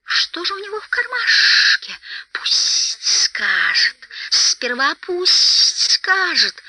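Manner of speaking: high, slow creature hissing; sharp hissed demand, drawn out and partly whispered; piercing hiss. A narrator's reading. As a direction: pierce with long, stretched sibilants. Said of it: "послышалось пронзительное шипение".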